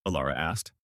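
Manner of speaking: ordinary delivery, nothing marked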